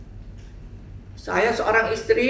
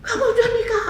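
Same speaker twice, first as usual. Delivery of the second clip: surprised; 3 dB louder, faster than usual. Retold or imitated. Surprised reply to news.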